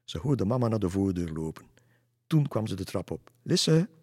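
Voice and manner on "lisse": loud high call up the stairs; mother calling her daughter upstairs, loud call. Acted. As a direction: call her name from below.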